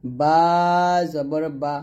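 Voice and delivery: long first বা, pause, short ending; drawn-out বা, then measured recitation; spelling-out drill. Shown as a demonstration. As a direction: intone বা slowly for learners. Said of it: "এরকমভাবে পড়বেন".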